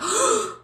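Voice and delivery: sharp, high gasp of shock. Retold or imitated. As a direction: gasp as if breath is taken away. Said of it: "And I was like".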